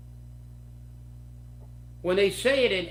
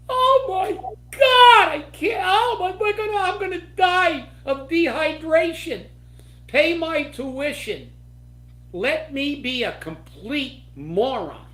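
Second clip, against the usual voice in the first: mocking whiny protester; 8 semitones higher, 6 dB louder than usual. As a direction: ham up a spoiled student wailing over a water bottle. Mocks protesters denied water.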